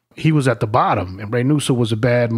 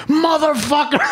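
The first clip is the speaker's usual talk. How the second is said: laughing high shout; angry yell. Retold or imitated. Recruit getting pissed on below, laughing yell. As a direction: yelp it out through a laugh. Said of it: "Ray Nusso says".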